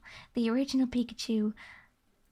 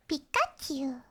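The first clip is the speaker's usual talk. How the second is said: Pikachu voice; 3 semitones higher than usual. Shown as a demonstration. Streamer trying Pikachu again for chat, squeaky. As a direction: squeak the name like the little Pokemon. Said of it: "Is that good?".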